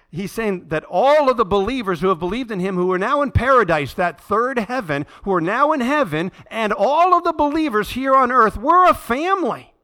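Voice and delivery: building to a loud, high preaching climax. Acted. Pastor telling the church believers are one family, building loud and high. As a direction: preach it up to a full-voiced peak.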